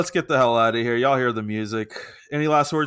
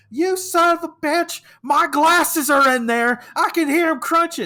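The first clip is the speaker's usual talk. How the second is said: high, loud, drawn-out mock yelling; outraged older lady; 6 dB louder than usual. Older lady to the man crushing her glasses, mock outraged yell.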